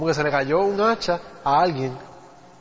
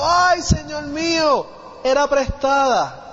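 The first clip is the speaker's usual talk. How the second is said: acted desperate shout; 8 semitones higher, much slower than usual. Preacher acting out the frightened man who lost the borrowed axe, desperate shout. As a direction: cry out high and drawn-out in dismay. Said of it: "él lo grita ahí, gritó diciendo".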